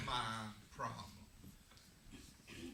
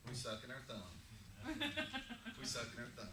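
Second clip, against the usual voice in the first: chuckling; 3 semitones lower than usual. Real, not acted. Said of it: "laughing admission".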